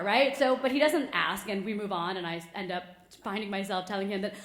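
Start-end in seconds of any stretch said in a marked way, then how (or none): none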